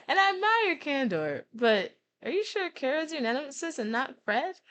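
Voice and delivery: high-pitched friend, tactfully questioning; doubtful Jesse. Acted. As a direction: pitch it up to challenge a friend politely. Voices Jesse doubting Grudge's choice of nemesis.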